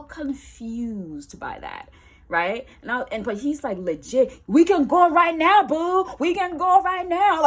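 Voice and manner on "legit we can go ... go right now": loud, high taunting challenge; fight challenge. Retold or imitated. Ex challenges her to fight, loud taunting. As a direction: dare her to fight right now.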